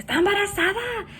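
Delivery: high, quick retold astonishment; amazed. Second oldest brother realising, high amazed imitation.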